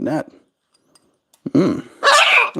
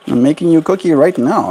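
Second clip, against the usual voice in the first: normal talking voice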